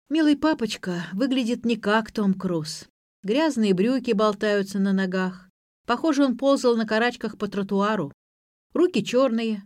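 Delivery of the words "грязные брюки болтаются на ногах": usual speaking voice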